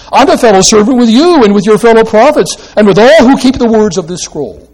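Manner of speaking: angel voice. Acted. Preacher voicing the angel.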